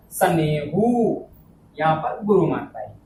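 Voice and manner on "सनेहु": सनेहु drawn out very slowly; long syllable. Shown as a demonstration. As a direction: stretch the vowels at the end of सनेहु. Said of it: "यहाँ गुरु आए".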